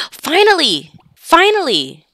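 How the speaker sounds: loud, high, drawn-out exclamation; exclaimed relief. Acted. Player cheering that it finally happened, exclaimed. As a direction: cry it out with a high swoop.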